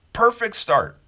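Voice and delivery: perfect start said high and loud, with a falling finish; emphatic. Real, not acted. To his viewers, about starting days positively, emphatic and bright. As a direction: land it like a happy verdict. Closing his hopeful morning wish.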